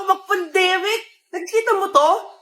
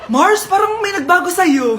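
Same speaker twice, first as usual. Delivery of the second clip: ordinary talk, nothing marked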